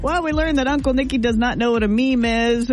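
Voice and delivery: ordinary talk, nothing marked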